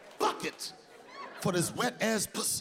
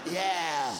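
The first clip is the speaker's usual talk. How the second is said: lower, slow, hoarse croak; hoarse laryngitis voice; 7 semitones lower, much slower than usual. Acted. Comic doing a dry croaky voice, slow hoarse rasp. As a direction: rasp it like you've lost your voice. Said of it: "laryngitis voice, an ashy ass voice".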